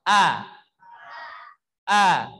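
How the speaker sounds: loud crisp A with a glottal onset; clear hamzah A. Shown as a demonstration. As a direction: strike the A cleanly from the throat. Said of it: "Bunyikan hamzah". (A dengan hamzah yang jelas)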